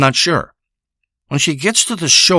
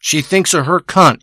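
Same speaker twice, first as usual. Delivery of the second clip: ordinary talk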